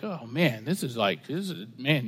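low, hushed aside in a listener's voice; doubtful muttering. Retold or imitated. Pastor voicing congregants uneasy with his God, muttered doubt.

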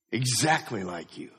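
usual speaking voice

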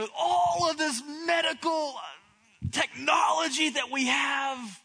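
half-shouting at the doctor in disbelief; pleading outburst. Retold or imitated. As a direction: pastor mock-shouting his protest to Doctor Wally. Pastor retells pleading with his doctor.

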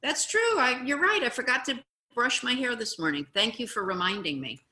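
high bright yeah, easy admission; cheerful agreement. Shown as a demonstration. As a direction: own it happily. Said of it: "you can say".

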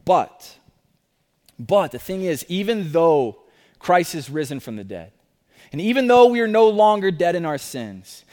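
normal talking voice

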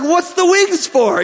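high, slow, baffled question about the wings. Retold or imitated. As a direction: ask it in mock disbelief, high and drawn out. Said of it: "I'm just like".

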